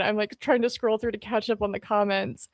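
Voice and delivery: usual speaking voice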